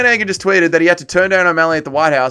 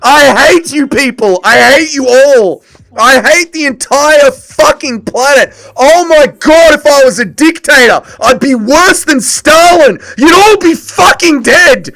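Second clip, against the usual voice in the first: screaming rage at the chat, high and loud; a full crash out, yelling at everyone; furious rant; 10 semitones higher, 8 dB louder than usual. Real, not acted. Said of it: "That is the comment of the year".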